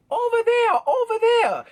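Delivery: very high mocking yelp; imitated angry shopkeeper. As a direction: shoo them away in a high, mocking voice. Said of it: "and she's like".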